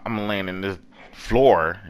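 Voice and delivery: ordinary talk, nothing marked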